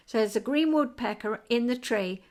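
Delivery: normal talking voice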